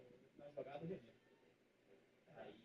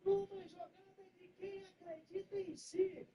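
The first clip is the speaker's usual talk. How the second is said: play-by-play narration; 7 semitones higher, slower than usual. Acted. Narrating the goal replay.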